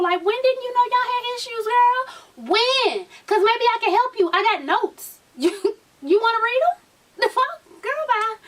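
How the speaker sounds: high-pitched, exasperated mock grilling; incredulous scolding. Vlogger grilling Yandy over known issues, incredulous scolding. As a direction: challenge her like you can't believe it.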